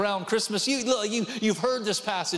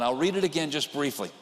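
ordinary talk

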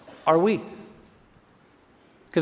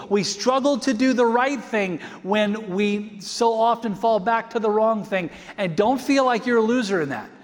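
raised pitch, pressing hard on every phrase; fervent preaching; 5 semitones higher than usual. Acted. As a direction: urge the church to hold on. Preaching at full force.

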